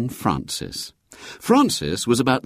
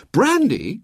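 put-on high, bright-eyed boy's query; cheerful; 4 dB louder than usual. A narrator's reading. William catching his mother's request, cheerfully. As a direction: pipe up cheerfully. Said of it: "said William cheerfully".

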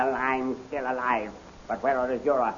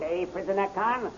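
usual speaking voice

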